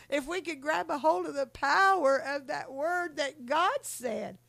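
high, rising preacher's cry, slowing on the last line; preaching at full force. Acted. Preacher to the congregation, high ringing full-force preaching. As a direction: lift the church, building to a peak.

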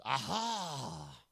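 long, drawn-out "aha" as the enemy; gloating enemy. Acted. The enemy pouncing on spoken worry, gloating. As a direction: draw it out like an enemy who has caught someone. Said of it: "the enemy goes".

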